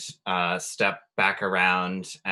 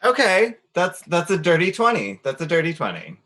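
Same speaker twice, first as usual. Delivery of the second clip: excited; 7 semitones higher, faster than usual. Real, not acted. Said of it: "dice roll excitement".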